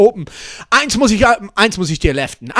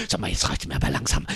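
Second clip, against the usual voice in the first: hushed grumble; 14 dB quieter, faster than usual. Acted. Annoyed character complaining.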